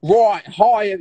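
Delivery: loud, high mock Australian greeting. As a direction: put on a broad, silly Australian accent. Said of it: "let me do an offensive accent".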